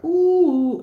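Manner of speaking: high, loud delighted ooh; jumping for joy like a kid, high loud squeal; childlike squeal of joy. Retold or imitated. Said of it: "I jumped up and down like a little kid".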